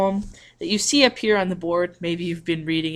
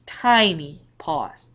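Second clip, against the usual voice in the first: slow tiny pause; much slower than usual. Shown as a demonstration. Teacher modeling a comma pause for students, slow and drawn out. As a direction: draw out tiny pause, slowly. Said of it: "It's just a simple".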